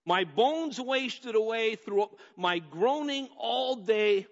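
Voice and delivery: high, loud, slow preaching; preaching at full force. Acted. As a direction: preach it to the congregation.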